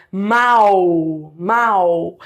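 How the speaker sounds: MAL drawn out, the a gliding into an oo sound; oral diphthong. Shown as a demonstration. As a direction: stretch MAL low and steady, ending in a w glide. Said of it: "no aparelho fonador aqui bocal".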